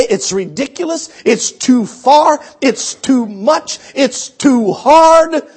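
slow, drawn-out whiny protest; mock complaining. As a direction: stretch every too like a reluctant believer. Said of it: "and you say".

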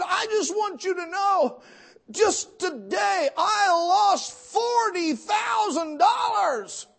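high, loud, slow wailing cry; a caller who just lost everything, crying out loud; imitated bawling. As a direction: wail it out like a broken man on the phone. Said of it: "He's bawling".

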